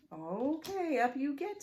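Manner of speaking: a lightly voiced little witch; obliging little witch. Witch invites another rider aboard.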